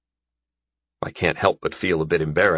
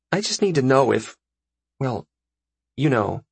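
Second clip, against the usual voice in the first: put-on higher girl's voice, halting; lightly voiced, embarrassed girl; hesitant girl; 5 semitones higher than usual. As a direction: trail awkwardly through the question with pauses. Voices Gilly's message.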